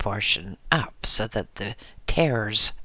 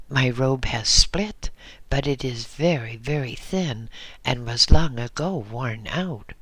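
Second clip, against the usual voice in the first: usual speaking voice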